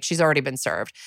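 usual speaking voice

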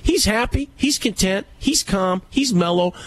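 high, gotcha tone; telling the woman off, high and triumphant; triumphant exactly. Retold or imitated. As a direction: land the point like a winner. Retold triumphant reply.

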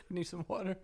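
breathy whispered aside. Real, not acted. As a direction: whisper it to yourself.

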